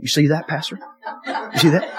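lightly imitated Southern accent. Brian asks his pastor about the flood, imitated Southern accent. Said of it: "In, in, in Southern accent".

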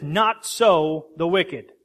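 "Not" hit loud, the line slowed right down; slow, heavy "Not so the wicked"; emphatic. Shown as a demonstration. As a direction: pound out "Not so" to the congregation. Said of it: "very emphatic here".

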